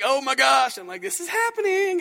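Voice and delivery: hammed-up wedding-day fright; mock terrified groom. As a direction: imitate a groom panicking as the doors open. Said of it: "husband just looks terrified".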